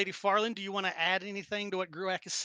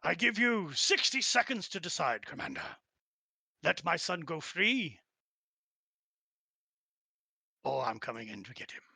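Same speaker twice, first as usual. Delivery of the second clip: lightly voiced orc war chief giving an ultimatum; measured threat from a war chief, dropping at the end. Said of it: "The orc war chief".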